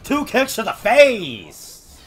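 loud, high gloating yell; triumphant shout. Real, not acted. Gamer gloating loudly at the opponent while landing a combo. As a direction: crow over the hits.

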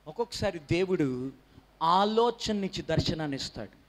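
ordinary talk

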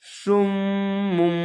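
সুম and মুম each held on a long humming m; ghunna. Shown as a demonstration. Teacher reading the letters by the rule, nasal ghunna. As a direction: hum the final m of সুম and মুম through the nose. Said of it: "এখানে গুন্না".

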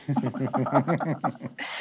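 low, drawn-out chuckle; a low laugh to unnerve someone; ominous laugh. Acted. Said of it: "Don't laugh like that".

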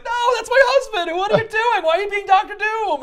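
a wife begging her husband back; distraught wife. Retold or imitated. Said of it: "the wife is like".

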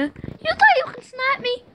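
high mocking taunt. Real, not acted. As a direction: jeer at the sniper. Taunting enemy.

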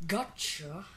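ordinary talk

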